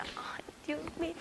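slow, barely audible whisper to God; dreading whisper. Retold or imitated. Daughter left alone at home with her father, dreading whisper. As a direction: breathe it out slowly, in a whisper.

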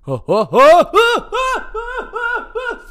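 string of loud, very high whoops; high-pitched comic hooting; mock panic yelps. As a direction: shriek in play-acted panic, then fade. Acts out flinging the snake.

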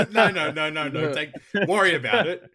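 high, loud laughing no; laughing protest. Real, not acted.

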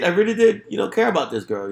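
usual speaking voice

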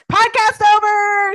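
loud, slow mock announcement; mock outrage. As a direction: declare it over in fake outrage. Mock storming off.

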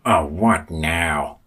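slow, drawn-out exasperation; exasperated. A narrator's reading. Ollie hears rustling behind a bush, exasperated. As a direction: drag the words out, fed up.

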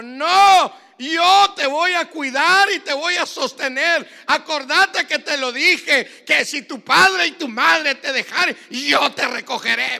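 high, very loud, commanding shout; full-force preacher voicing God; God's booming answer. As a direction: thunder it as God's promise from above. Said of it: "bajó la voz y me dijo".

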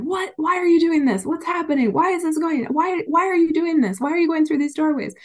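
high, exasperated mock husband voice; mimicking husband. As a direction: squeak out the husband's panicked questions. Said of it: "looking at the bills coming in and going".

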